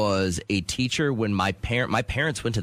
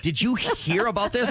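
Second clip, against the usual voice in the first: high, eager gossipy voice; imitating his wife; 11 semitones higher than usual. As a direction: mimic an excited wife's morning gossip. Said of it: "This is how Kat talks to me".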